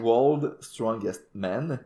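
World Strongest Man said very slowly and deliberately, word by word; carefully spelling out World Strongest Man after tripping over it; slow, deliberate retake; slow careful restart. Shown as a demonstration. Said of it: "Reprends-toi".

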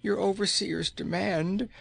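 high, strained pleading voice; weak pleading woman. A narrator's reading. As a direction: plead as a weakened slave. Weak slave woman answers queen.

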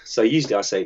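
ordinary talk, nothing marked

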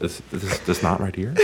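low, soft stammer on one word; Jeff Goldblum. Acted. Showing the knot as the actor, quiet stuttering impression. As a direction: repeat haltingly, quiet and low. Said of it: "I can't do his voice".

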